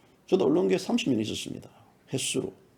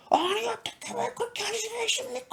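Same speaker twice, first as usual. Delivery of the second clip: very high squeal imitating an outraged critic; mock indignant tirade; 4 dB quieter than usual. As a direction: mimic a scolding viewer in a squeaky falsetto. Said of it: "저한테 막 퍼붓더라고요".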